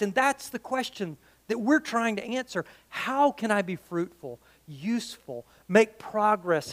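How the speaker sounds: normal talking voice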